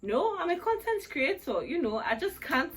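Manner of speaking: high, loud protest breaking into a laugh; mock protest. Content creator refusing to wash off makeup, mock protest. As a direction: object loudly and high, laughing partway through. Acted quote of own protest.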